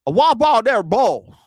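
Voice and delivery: mangle "A watch pot never boils" in a mock Virginia twang; ex-father-in-law's Virginia accent. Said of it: "he used to talk like that".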